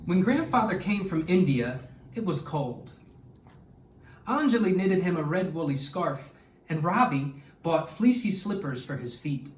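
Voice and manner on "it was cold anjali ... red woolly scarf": plain reading voice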